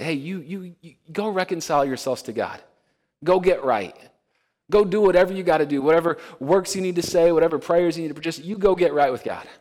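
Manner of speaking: pushy, hurried orders; mock demanding. Preacher mocks a works-based demand, pushy and rising. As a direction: bark orders like a taskmaster.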